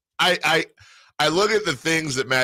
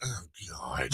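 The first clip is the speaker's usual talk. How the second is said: hushed, much lower aside; whispered dread; 9 semitones lower, 13 dB quieter than usual. Acted. Host voicing what everyone was secretly thinking, whispered. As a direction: drop to an unvoiced whisper. Hosts' shared private dread on air.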